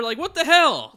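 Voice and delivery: high, loud incredulous yell; mock outrage. Real, not acted. Fan complaining about recasting, high loud mock outrage. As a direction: shout it in laughing exasperation.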